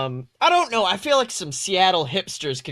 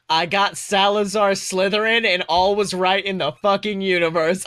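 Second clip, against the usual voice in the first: ordinary talk, nothing marked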